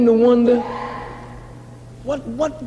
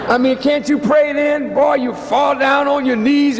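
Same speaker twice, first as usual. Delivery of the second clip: normal talking voice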